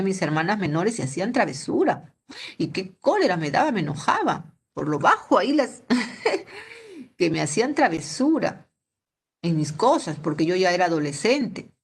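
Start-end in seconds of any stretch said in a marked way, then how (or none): 5.9-6.6 brief high laugh at herself; short laugh. Real, not acted. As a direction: laugh lightly at the memory. Laughs at own story.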